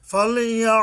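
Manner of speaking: loud high recited ফালিয়া; careful ain. Shown as a demonstration. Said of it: "আইন উচ্চারণে সাবধান হতে হবে".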